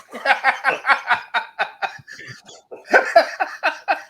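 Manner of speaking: long, high-pitched laughing fit; real laughter. Real, not acted. Friends after a joke's punchline, laughing hard.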